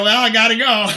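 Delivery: high, loud, half-laughing sign-off; mock awkward exit. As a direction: play up an awkward excuse to hang up. Acts out awkward exit from call.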